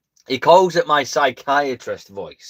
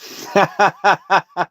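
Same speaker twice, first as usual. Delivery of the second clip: burst of laughter; 6 semitones higher, 4 dB louder than usual. Real, not acted. Laughs after joke about channel.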